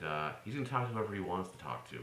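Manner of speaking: normal talking voice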